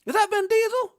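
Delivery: high, loud amazed onlooker; amazed bystander. Retold or imitated. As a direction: gasp it as a stunned bystander. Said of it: "they gonna be like".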